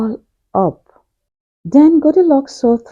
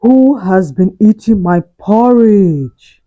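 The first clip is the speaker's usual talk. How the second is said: big, slow, loud bear voice; Father Bear's loud voice; 5 dB louder than usual. Acted. Father Bear at his porridge bowl, loud and slow. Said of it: "said in a very loud voice".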